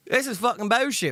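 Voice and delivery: a touch of English accent; mildly indignant, English-accented. Real, not acted. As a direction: repeat it with a slight English accent. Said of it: "the English accent".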